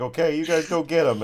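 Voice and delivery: usual speaking voice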